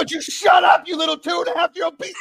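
high, loud hammed-up outburst; mock furious yell. Dad retelling yelling at his two-year-old, hammed-up shout. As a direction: yell at the toddler in mock rage. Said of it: "retelling yelling at toddler".

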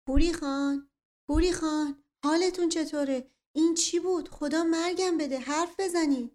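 high frantic scream. Acted. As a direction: cry out in panic. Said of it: "صدای فریاد اختر".

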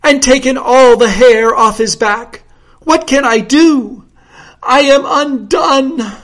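high, loud countess in despair; hammed-up anguished lament; theatrical moan. A narrator's reading. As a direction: wail it like a melodrama heroine. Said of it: "She wrung her hands and moaned".

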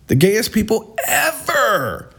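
drawn out high and slow; mock it, high and slow; stretched high sneer on "The gayest people ever"; high mocking repeat. Shown as a demonstration. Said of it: "repeat mocked, high slow".

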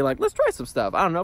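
normal talking voice

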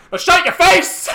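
high, loud snap; mock angry shout. Madame Thenardier to little Cosette, mock angry shout. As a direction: yell it as a hammed-up scolding.

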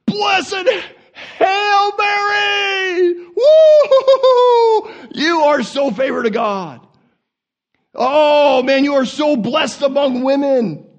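very high, loud ecstatic shouting; overexcited angel. Acted. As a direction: whoop and holler the good news, hammed up. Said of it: "he couldn't wait".

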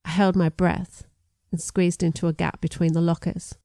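ordinary delivery

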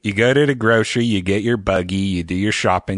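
hammed-up Pittsburgh local; Pittsburgh accent. Shown as a demonstration. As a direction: lay on a thick Pittsburgh accent. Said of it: "That's a good accent".

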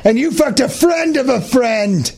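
high, angry shouted accusations; shouting. Pretend. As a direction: yell the accusations at her. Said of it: "shouting at Margaret".

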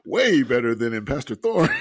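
normal talking voice

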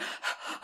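sudden frightened gasp; quick panicked intake of breath; sharp gasp. Retold or imitated. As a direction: catch your breath in alarm. Panic rising over pain.

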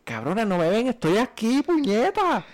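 frantic ghost yell. Retold or imitated. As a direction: shout to be seen, climbing in pitch. Ghost yelling at mourners in dream.

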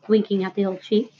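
plain reading voice

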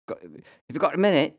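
high, loud mock British Prince Harry voice; a hammed-up posh English royal on the phone; British accent impression. [name] doing Harry calling him, British accent. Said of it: "my accent falls apart".

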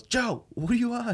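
high mock shout across the room; yelling across the room. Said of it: "he just yells across the room".

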